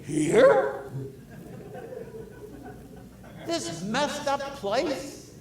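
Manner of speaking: astonished, drawn-out question; incredulous. Acted. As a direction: ask it as if it's unthinkable. Mock disbelief at Jesus coming here.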